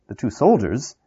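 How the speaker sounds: usual speaking voice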